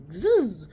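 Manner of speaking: loud buzzing "zzz" sound effect; imitated zip noise. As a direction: mimic a badge reel zipping out. Imitates name tag being pulled out.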